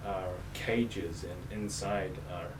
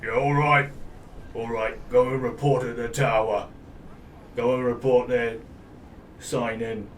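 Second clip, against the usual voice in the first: shouted guard calling travellers over; 3 semitones higher, 8 dB louder than usual. Acted. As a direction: call it out across the bridge like a bored guard. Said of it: "sort of calls out".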